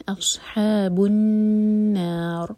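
أَصْحَابُ النَّارِ with a full-mouthed صاد and heavy را; chanted النَّارِ; heavy saad. Shown as a demonstration. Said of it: "heavy کر کر پڑھیں گے".

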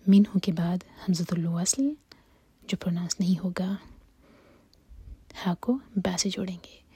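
fast whispered teacher's aside; whispering. Real, not acted. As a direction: whisper the rule quickly. Whispered tajweed explanation.

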